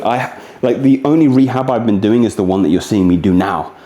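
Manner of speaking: ordinary delivery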